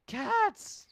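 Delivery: very high loud cry; the stranger yelling to make Sasayama understand; frantic cry. Acted. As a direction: shout the word in exasperation. Stranger's desperate emphasis.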